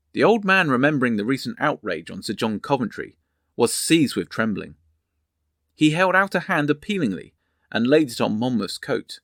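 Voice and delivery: usual speaking voice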